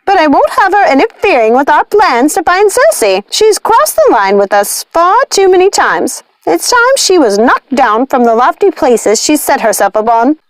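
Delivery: high witch voice, scornful. A narrator's reading. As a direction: cut the Dark Fairy down. Lucinda scorns Maleficent.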